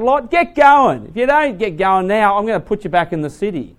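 loud, high impatient command; preacher playing the angel, impatient and loud; impatient order. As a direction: snap him into moving. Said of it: "I'd sit there going".

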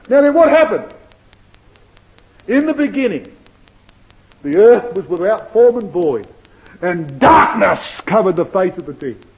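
loud rising sermon voice; booming biblical narration. Acted. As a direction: proclaim it from the pulpit. Preacher building to Genesis.

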